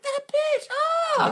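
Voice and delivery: very high squealed complaint; mock outrage. Boy discovering his bike was stolen, mock outrage. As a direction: squeal it in hammed-up indignation.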